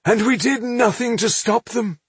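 high, stricken realisation; appalled. A narrator's reading. Rodario pales at the slaughter they allowed, appalled. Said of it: "Rodario grew pale".

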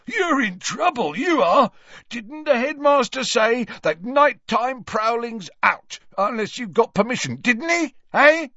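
very high, wheezy caretaker's scolding; Filch, gloating. Acted. Caretaker threatens a prowling boy, high and gloating. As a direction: crow over the culprit, loud at the ends.

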